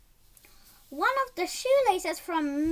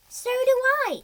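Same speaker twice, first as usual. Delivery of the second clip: a high, loud burst of agreement; a hint of an excited husband; lightly voiced husband; 9 semitones higher, 7 dB louder than usual. A narrator's reading. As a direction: chime in eagerly, voice up high. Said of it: "said Miss, Mister Ping".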